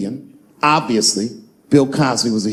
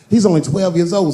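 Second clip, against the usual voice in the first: ordinary talk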